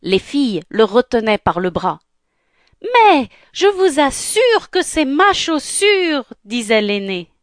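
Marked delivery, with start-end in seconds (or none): 2.8-6.2 very high, drawn-out stepsister protest; whining insistence. Acted. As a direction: plead your claim, stretching the words. Elder sister insists, quarrelling over shoe. (insistance geignarde, aiguë et traînante)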